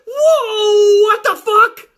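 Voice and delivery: high, loud cracking disbelief; shocked fan. Retold or imitated. Fan watches the bell ring, high and shocked. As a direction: shout it in shock. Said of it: "you're like".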